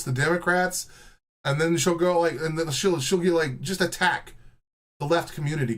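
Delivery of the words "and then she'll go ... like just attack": ordinary talk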